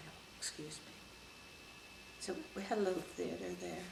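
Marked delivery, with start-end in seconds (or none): 0.1-0.9 breathy whisper, barely audible; soft whisper. Retold or imitated. Whispering her apology to the angry general. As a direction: whisper it so the general can't hear. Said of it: "he couldn't hear me very well".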